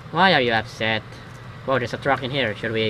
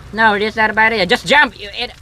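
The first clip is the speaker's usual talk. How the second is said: high, loud, exasperated gun character; mock scolding; 6 semitones higher, 5 dB louder than usual. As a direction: snap the order impatiently, pitched up. Revolver character scolds the player.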